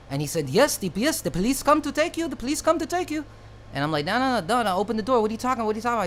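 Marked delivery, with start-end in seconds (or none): 0.5-3.2 high-pitched mock Indian accent, much higher than his own voice; exaggerated Indian shopkeeper impression; imitating the Indian clerk. 4.0-6.0 panicked pleading. Retold or imitated. Kid pleading with clerk.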